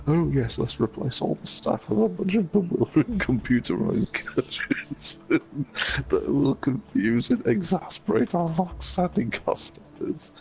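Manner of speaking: high mocking sarcasm, breaking into laughs; shrill laughing mockery; sarcastic major. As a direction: sneer it up high, laughing at the idea. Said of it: "major misunderstanding sees him and says".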